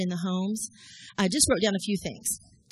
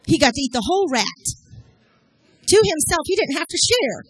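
higher, louder mock delight; higher and louder, ironic glee over the whole rat; mock excitement; 7 semitones higher, 8 dB louder than usual. Real, not acted. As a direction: sell the gross treat as a prize. Rat story told with mock glee.

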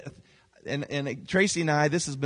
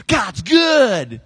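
shouted praise; 6 semitones higher, 8 dB louder than usual. Retold or imitated. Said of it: "Joseph is going".

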